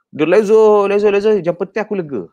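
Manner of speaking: high, loud carefree venting voice; hammed-up carefree ranter; mock careless venting. Husband carelessly venting anger, a hammed-up carefree ranter.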